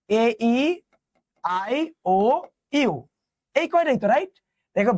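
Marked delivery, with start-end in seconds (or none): none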